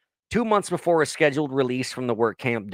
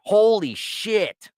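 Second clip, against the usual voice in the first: high, drawn-out shock; stunned; 10 semitones higher, 4 dB louder, much slower than usual. Real, not acted. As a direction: gasp it out slowly. Reacting to gruesome detail.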